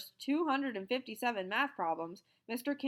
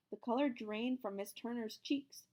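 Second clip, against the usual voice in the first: plain reading voice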